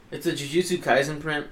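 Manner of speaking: normal talking voice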